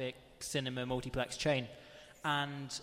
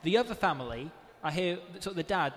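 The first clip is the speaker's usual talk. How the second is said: ordinary delivery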